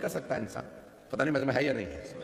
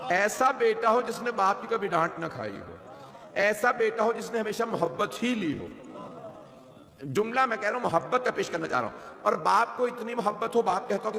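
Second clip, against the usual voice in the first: impassioned preaching; 6 semitones higher than usual. Acted. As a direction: preach it out to the gathering with rising fervour. Rising Muharram majlis sermon.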